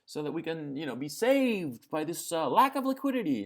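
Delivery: high mocking voice begging for liquidity; mocking whiny plea. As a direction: ham it up like a whining banker. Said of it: "they keep saying that there's a lack of liquidity".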